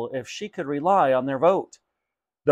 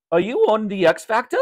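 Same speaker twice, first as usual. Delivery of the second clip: excited shopkeeper, higher and louder; eager question in a raised character voice; Raj, excited; 7 semitones higher, 5 dB louder than usual. Acted. Shopkeeper quizzes his customer about X Factor, excitedly. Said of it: "said Raj excitedly".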